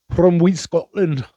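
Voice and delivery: high, slow, hammed-up Scottish brogue; mock Scottish accent, loud and drawn out; bad Scottish accent. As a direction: put on a terrible Scottish accent on purpose. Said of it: "the absolute worst fucking Scottish accent".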